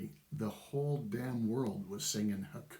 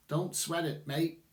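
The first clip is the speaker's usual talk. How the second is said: bad Australian accent; 5 semitones higher than usual. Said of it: "Sorry for the Australian accent there, it's terrible".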